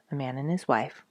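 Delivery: ordinary delivery, nothing marked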